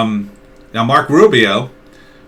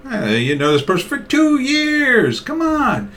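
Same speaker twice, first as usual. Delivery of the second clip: much higher, wheedling come on; high cajoling nudge; coaxing plea. Acted. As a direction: coax a friend who holds back. Mock pleading with friend.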